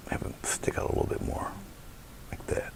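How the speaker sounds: soft whisper to himself. Real, not acted. Painter whispering to himself over the canvas. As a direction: breathe it out while working the brush.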